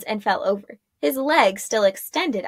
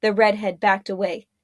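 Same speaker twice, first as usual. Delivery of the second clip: ordinary delivery, nothing marked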